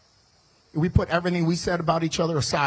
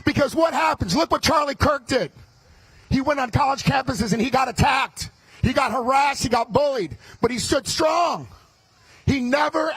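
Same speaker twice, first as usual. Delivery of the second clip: fiery rally speech; 7 semitones higher than usual. Acted. Candidate firing up the Republican crowd, shouting. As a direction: fire up the crowd at full volume. Rallies the crowd at full force.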